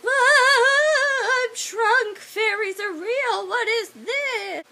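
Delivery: very high panicked wail; freaking out. Acted. Shrunken man suddenly sees fairies, hammed-up freak-out.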